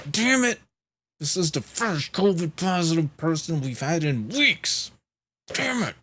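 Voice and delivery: mock-curse the bad luck in a high yell; angry outburst. Assistant cursing his team over a positive test, in a high angry yell. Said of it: "being angry at, at, like, his team".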